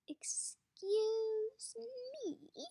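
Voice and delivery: very slow, high drawn-out "Excuse me."; icy interruption. Acted. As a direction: stretch every syllable as a warning. Warden cutting off sharply.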